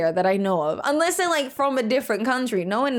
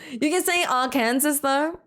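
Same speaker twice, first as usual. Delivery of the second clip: usual speaking voice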